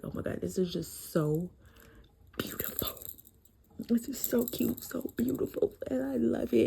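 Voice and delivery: high, breathy near-whisper gush of delight; gushing squeal. Retold or imitated. Reader retelling her reaction to the wedding, squealing in awe. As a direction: melt over something beautiful. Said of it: "And I was like".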